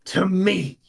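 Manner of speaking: angry retort. Acted. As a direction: bite back at the accuser. Heated argument.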